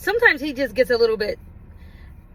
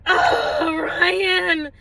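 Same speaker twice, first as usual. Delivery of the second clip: higher, drawn-out exasperated call; mock scolding; 4 semitones higher than usual. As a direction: scold while holding back a laugh. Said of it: "I was trying so hard to not laugh".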